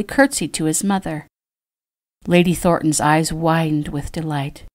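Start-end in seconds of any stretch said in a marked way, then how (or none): none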